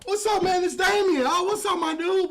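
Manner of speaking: loud, high, thrilled hello to a friend; excited shouted greeting. Real, not acted. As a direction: call out his name like you're overjoyed. Loud greeting on meeting in person.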